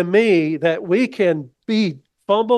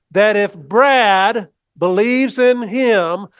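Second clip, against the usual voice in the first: slow, louder read-along of the verse, name put in; congregation read-along; 3 semitones higher, 4 dB louder, slower than usual. Shown as a demonstration. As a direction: lead the room through the verse word by word. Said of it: "read this with me".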